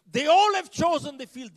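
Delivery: high, forceful shout as the boss; retold emphatic shout. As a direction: hammer the point home at full volume. Acted manager hammering the point home.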